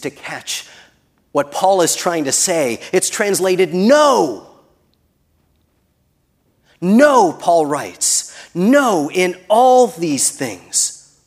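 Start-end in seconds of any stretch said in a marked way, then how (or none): 3.7-11.1 "no" drawn out, raised and loud; emphatic NO. Real, not acted. Preacher proclaiming Paul's stronger word, emphatic "no".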